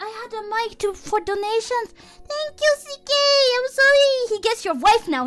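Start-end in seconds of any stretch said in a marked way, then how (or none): 2.3-4.1 very high, cutesy thank-you; squeaked thanks. Real, not acted.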